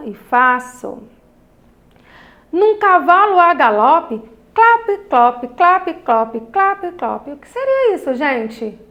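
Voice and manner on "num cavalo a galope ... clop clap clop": bouncy galloping rhythm on clap clop; rhythmic poem reading. Shown as a demonstration. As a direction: read it like a horse trotting. Reads galloping onomatopoeia line. (leitura ritmada imitando o galope)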